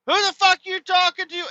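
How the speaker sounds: put-on very high, aggressive girl voice; imitating a girl starting a brawl; girl picking a fight. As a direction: squawk it like an offended teen girl. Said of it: "she's like".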